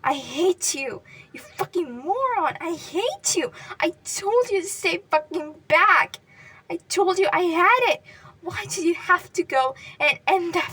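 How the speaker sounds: loud, high, anguished scream. Acted. Katsuki screaming at Deku's pale face, anguished. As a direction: scream the grief out at him.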